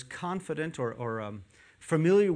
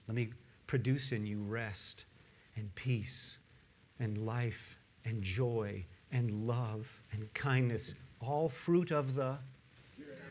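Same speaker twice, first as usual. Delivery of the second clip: gentle God voice; 4 semitones lower, 6 dB quieter than usual. Pretend.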